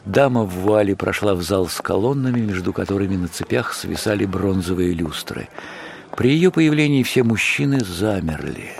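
ordinary delivery

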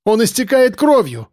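high, breaking cry of despair; отчаянный крик. A narrator's reading. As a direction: call out as the voice cracks. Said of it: "Голос её срывался".

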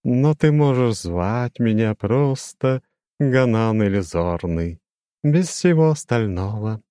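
high, slow, chiming demon voice; lofty demon with a glassy ring; crystal ringing voice. A narrator's reading. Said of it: "прозвенел демон своим хрустальным голосом". (высокий звенящий хрустальный голос демона)

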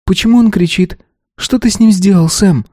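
put-on scared girl asking in alarm; frightened girl. A narrator's reading. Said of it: "Глаза её были огромными от страха".

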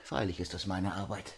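hushed, soft voice of a goldsmith; Cardillac in character. A narrator's reading. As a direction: answer under your breath as the proud craftsman. Cardillac antwortet der Marquise. (leise Rollenstimme eines Goldschmieds)

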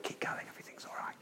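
quick, barely audible whisper; mock-dainty whispered reassurance; dainty, gentle. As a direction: whisper it feebly, as empty comfort. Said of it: "It's not the dainty, gentle".

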